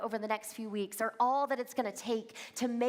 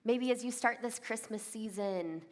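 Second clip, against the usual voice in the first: ordinary delivery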